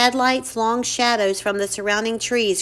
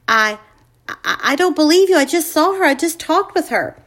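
louder and higher, protesting in shock; shocked disbelief; 6 semitones higher, 6 dB louder than usual. Acted. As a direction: push back hard, stunned. Mike refuses to believe mother.

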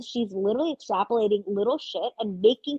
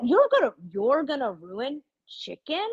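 incredulous rant; 4 semitones higher, slower than usual. Real, not acted. Ranting in disbelief at Sydney.

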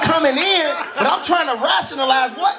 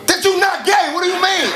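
high-pitched protest; 7 semitones higher than usual. Retold or imitated. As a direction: protest it in disbelief. Protesting friend's confession.

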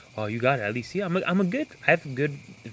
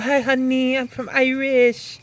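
high, louder mock Irish; bad Irish accent; 11 semitones higher, 4 dB louder, slower than usual. Defending his accent to co-host, bad high Irish accent. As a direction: insist with a badly faked, drawn-out Irish accent. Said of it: "that's what they sound like".

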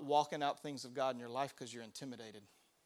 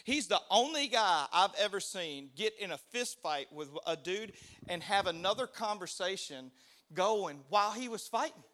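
usual speaking voice

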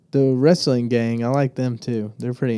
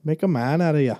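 mock Irish; 3 semitones higher, faster than usual. Putting on Irish voice.

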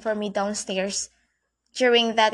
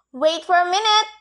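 called out; 7 semitones higher, 4 dB louder than usual. A narrator's reading. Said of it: "I answered".

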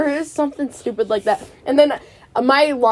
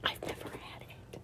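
whisper; 25 dB quieter than usual. Real, not acted.

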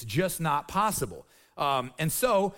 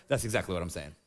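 deadpan firm; 12 semitones lower, 5 dB quieter, faster than usual. Real, not acted. Firm low answer.

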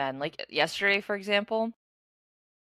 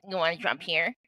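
mocking parrot of her voice; put-on mimicking voice, teasing; mocking impression. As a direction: echo it back to make fun. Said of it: "making fun of my voice".

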